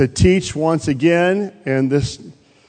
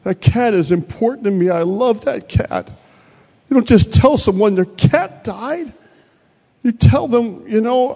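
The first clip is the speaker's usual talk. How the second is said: high-pitched, distressed protest; retold hurt, pitched well up; mock tearful upset; 8 semitones higher than usual. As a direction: imitate a sister upset over her cat. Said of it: "started to cry and said".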